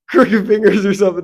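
mock it through a laugh; laughing mockery. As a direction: laugh out the silly punchline. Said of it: "No, I'm just joking".

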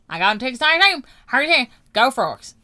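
high, loud mock Midwest-Southern accent; hammed-up Midwest-Southern girls, pushed loud and high. Imitating TikTok girls for friends, mock Midwest-Southern accent. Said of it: "They're out here saying".

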